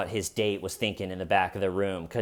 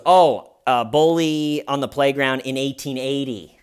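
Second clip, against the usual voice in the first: exasperated explanation; 3 semitones higher, 7 dB louder than usual. Retold or imitated. Gareth explaining joke.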